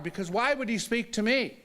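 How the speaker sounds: usual speaking voice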